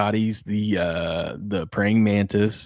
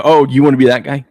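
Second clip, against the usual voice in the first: mock surprise; 5 semitones higher, 10 dB louder, faster than usual. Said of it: "I go".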